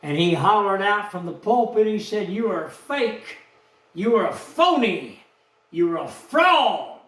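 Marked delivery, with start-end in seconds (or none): none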